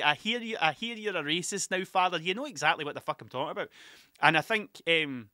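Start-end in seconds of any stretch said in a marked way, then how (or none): 0.0-2.2 put-on Irish sitcom-quote accent. Showing listeners the Father Ted line, Irish accent. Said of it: "I start doing an Irish accent".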